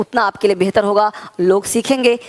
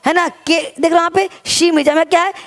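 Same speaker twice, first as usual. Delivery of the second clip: ordinary talk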